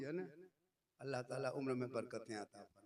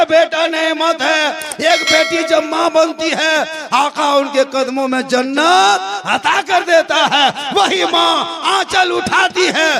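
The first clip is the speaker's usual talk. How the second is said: fiery preaching; 12 semitones higher, 28 dB louder than usual. Acted. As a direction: preach it to the whole gathering at full voice. Preaching at full force.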